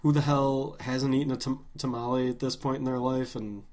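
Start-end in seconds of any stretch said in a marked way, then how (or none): none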